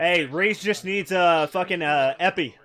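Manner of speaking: man down shout. Real, not acted. Urgent call for a wounded teammate.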